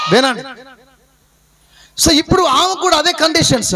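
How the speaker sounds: normal talking voice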